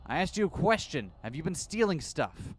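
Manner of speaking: pressing. Acted. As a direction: push them for an answer. Susumu presses his silent men.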